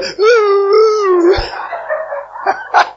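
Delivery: high-pitched fake wail; mock crying. Shown as a demonstration. Pastor shows how he doesn't cry, mock wailing. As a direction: wail in an exaggerated boo-hoo. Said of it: "I know how I cry. I don't go".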